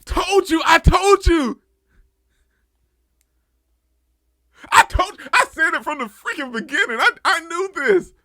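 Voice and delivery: real triumphant yelling; triumphant shout. Real, not acted. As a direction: shout I told you as the guess pays off. And I screamed it from the freaking beginning.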